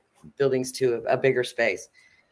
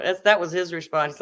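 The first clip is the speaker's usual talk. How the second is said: ordinary delivery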